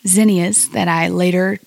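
ordinary talk

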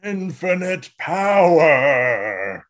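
drawn-out, loud mock-epic cry; booming power cry. Co-host mocking Bumblebee gaining the Matrix, booming and drawn out. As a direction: stretch it out like a supreme being unleashed.

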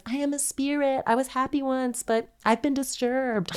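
high, sustained ghostly voice; mock spirit voice. Ghost explains itself to the family, high mock-eerie voice. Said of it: "they'll hear a voice that says".

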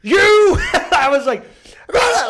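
high, loud wordless outburst; sputtering mock rage. Sputtering at his roommate, high and loud. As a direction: blurt out helpless anger. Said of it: "got so mad".

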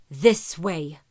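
low, loud impatient growl; gruff growl. A narrator's reading. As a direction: bark it out, losing patience. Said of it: "in an even gruffer growl".

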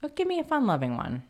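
fast, high and bright; playful. Real, not acted. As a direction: ask eagerly. Asks playfully.

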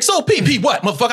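high-pitched, fired-up challenge. Retold or imitated. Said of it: "I was fired up".

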